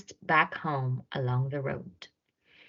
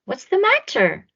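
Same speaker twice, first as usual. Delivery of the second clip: lightly voiced kindly giant, higher; a caring question from the giant; George, friendly giant; 13 semitones higher, 4 dB louder than usual. A narrator's reading. George asks the crying mouse what's wrong, lightly voiced.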